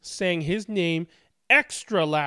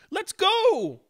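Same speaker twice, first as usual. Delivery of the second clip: much higher, louder shout of joy; excited yell; 14 semitones higher, 5 dB louder than usual. A narrator's reading. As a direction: burst out eager to leave. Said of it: "yelled X".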